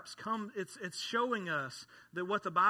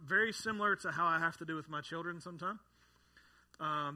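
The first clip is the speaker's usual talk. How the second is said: normal talking voice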